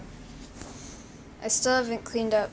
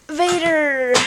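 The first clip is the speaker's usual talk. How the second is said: announcing name; 4 semitones higher, 8 dB louder than usual. Pretend. Announcing cat.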